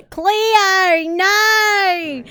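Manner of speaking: high, loud shouted warning; cartoon shout. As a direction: shout the name like a panicked warning. Repeats the cartoon scolding louder.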